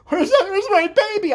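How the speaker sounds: high, loud panicked cry; hammed-up motherly panic; mock hysterical mom. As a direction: shriek for your lost baby. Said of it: "My mom's in hysterics".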